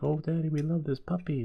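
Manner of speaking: put-on high-pitched excited kid; imitating an eager kid; children's voice. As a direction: gush like a child adoring a puppy. Kids pleading over a puppy.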